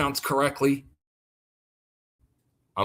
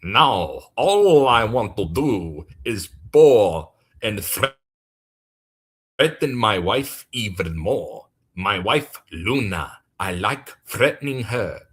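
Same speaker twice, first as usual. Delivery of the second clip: lower, slow menacing boast; impression of a wife-threatening goddess; 5 semitones lower than usual. Mocking a self-styled goddess who threatens subscribers. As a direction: loom over every word, mock villain. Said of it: "I'm gonna do one more impression".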